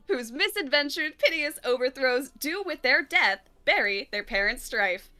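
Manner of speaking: theatrical Shakespeare recital, measured and rising; a stage reading for the stream chat; dramatic prologue reading. Acted. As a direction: declaim the prologue like a Watch Mojo host. Reads the Romeo and Juliet prologue.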